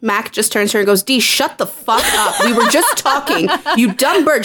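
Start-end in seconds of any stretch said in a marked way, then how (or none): 1.0-4.4 mildly raised, imitated telling-off; mock put-down. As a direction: act out shutting someone up, amused. Acted put-down of Dee.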